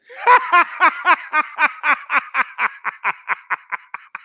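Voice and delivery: long, high, loud gloating laugh. Real, not acted. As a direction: laugh at the rival's loss. Gloating over Duke's loss.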